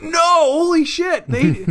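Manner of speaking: high-pitched, shocked outburst; caught off guard. Retold or imitated. Fan retelling his shock at a character's death, high-pitched disbelief. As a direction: reenact a stunned yelp, pitch shooting way up. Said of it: "I was caught off guard".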